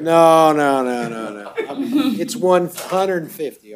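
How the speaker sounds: chuckling through a string of no's, starting loud; amused laughing correction. Real, not acted. Judge reassuring defendant it isn't a hundred fifty thousand, laughing. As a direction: laugh as you put the misunderstanding right. Judge clears up a joking bond misunderstanding.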